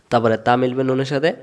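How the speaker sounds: ordinary delivery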